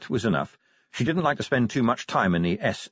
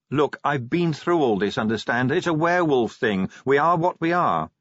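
higher-pitched exasperated explanation; Angua, defensive werewolf; 5 semitones higher, 3 dB louder than usual. Acted. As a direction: shut down the advice, pitch raised.

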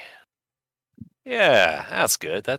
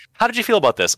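much higher, louder question; interviewer voice; 13 semitones higher, 4 dB louder than usual. Pretend. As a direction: ask it like a chirpy reporter.

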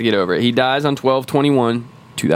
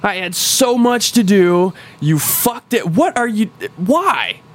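much higher, mock anguished protest; a hammed-up dying target raging at his killer; dying villain's rant; 9 semitones higher, slower than usual. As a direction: wail it like a dying tyrant cut short. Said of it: "they're just kinda pissed".

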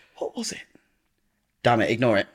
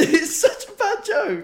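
high, drawn-out, laughing wail; laughing rebuttal; 12 semitones higher, slower than usual. Real, not acted. Friend panning the bad joke, laughing helplessly. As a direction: argue back while cracking up.

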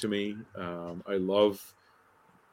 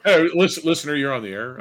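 higher, louder mock radio host greeting; mock call-in host; 7 semitones higher, 7 dB louder than usual. Host jokingly takes his co-host as a caller, mock radio host. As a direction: play a call-in host putting a listener on.

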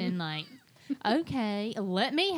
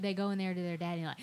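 ordinary talk, nothing marked

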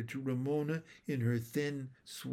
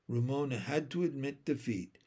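ordinary delivery, nothing marked

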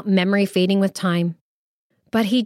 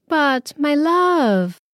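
slow, higher tender plea; gently calm him down; soothing; 6 semitones higher, much slower than usual. Acted. Wife comforts her weeping husband, slow soothing. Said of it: "soothed Kiren".

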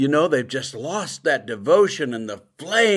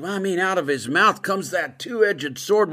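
ordinary talk

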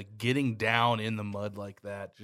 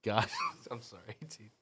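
usual speaking voice

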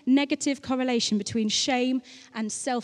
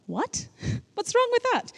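dog's innocent reply; 12 semitones higher than usual. Retold or imitated. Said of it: "he looked at me like".

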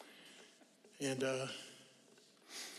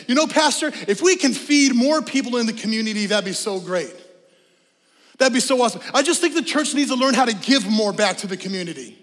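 mock churchgoer; 5 semitones higher, 7 dB louder than usual. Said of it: "A symptom might sound like this".

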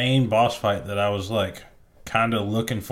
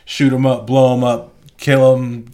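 loud, punched short phrases; emphatic list; 2 semitones higher, 7 dB louder than usual. Real, not acted. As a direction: punch "shoot 'em up", "blow 'em up", "kill 'em" loudly, one by one. Listing the game's action with gusto.

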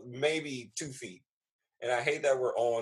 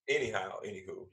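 usual speaking voice

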